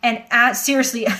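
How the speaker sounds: ordinary talk, nothing marked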